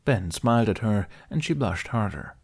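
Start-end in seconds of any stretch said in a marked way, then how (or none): none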